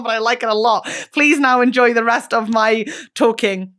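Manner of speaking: usual speaking voice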